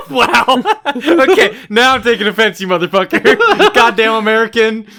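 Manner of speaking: laughing fake outrage; mock offense laughing. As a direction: play offended while cracking up.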